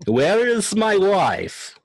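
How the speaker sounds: put-on high, drawn-out Russian accent; exaggerated slow Russian drawl; mock Russian accent. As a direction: stretch it out as a hammed-up Russian. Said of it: "More like a Russian drawl".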